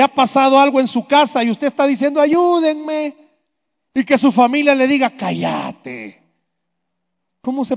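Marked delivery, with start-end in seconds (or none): 2.2-3.2 higher, pleading cry for help. Pretend. A person begs their family for help, pleading. 5.1-6.2 softer, lower cutting hush; curt hush. Pretend. Family tells the one begging to hush, low curt hush.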